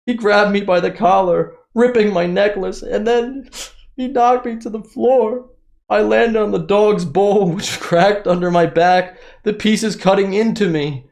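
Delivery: very high, slow, put-on whiny voice; mock-victim Harry. Reading Harry's account of being knocked down, mockingly melodramatic. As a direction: ham it up as a pitiful victim, breaking into laughs.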